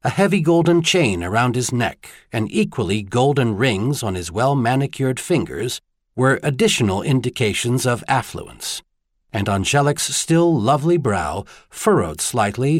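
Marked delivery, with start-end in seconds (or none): none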